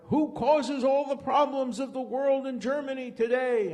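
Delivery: high, loud ranting speech cry; shouting demagogue at a rally; Hitler screaming. Retold or imitated. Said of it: "screams out".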